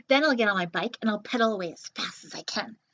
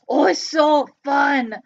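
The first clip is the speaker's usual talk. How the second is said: a bouncy little puppet popping up; 8 dB louder, slower than usual. Pretend. Puppet Joe talks.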